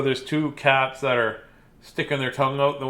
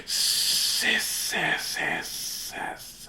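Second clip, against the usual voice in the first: soft voiceless spooky hiss; quiet eerie hissing; horrible hissing; 7 semitones higher, 8 dB quieter, much slower than usual. A narrator's reading. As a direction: hiss like something lurking in the dark. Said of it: "a horrible hissing sound".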